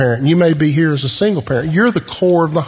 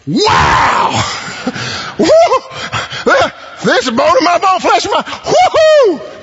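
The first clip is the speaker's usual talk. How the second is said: ecstatic Adam; 14 semitones higher, slower than usual. Acted. Preacher acting Adam seeing Eve for the first time, whooping with delight. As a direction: shout it out like a man overjoyed. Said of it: "I think it's something like".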